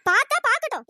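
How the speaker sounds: loud, defiant snap back; angry shout. Acted. Girl to the boy who begs her to stop, loud defiant shout. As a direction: let everyone hear it, shout it out. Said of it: "இப்படி கத்தி கத்தி பேசிட்டு இருக்கேன்".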